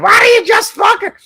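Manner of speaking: high, loud mock outburst; imitated shout. Streamer mimics fiancee's outburst, high mock shout. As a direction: blurt it as a mock shriek.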